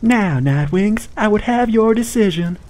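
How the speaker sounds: high, slow formal announcer; high herald voice. Acted. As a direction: demand it grandly and slowly.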